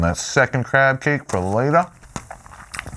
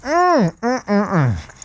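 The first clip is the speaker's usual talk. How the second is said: delighted humming; 9 semitones higher, slower than usual. Real, not acted. Tasting burger, delighted hums.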